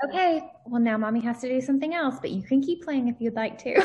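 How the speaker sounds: high, sweetly polite parent voice; mommy voice. Retold or imitated. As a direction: excuse yourself brightly to a small child. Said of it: "And then I say".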